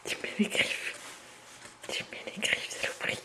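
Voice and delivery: whispered complaint at the cat; whispered grumble. Real, not acted. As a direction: grumble it under your breath. Repeats complaint under breath.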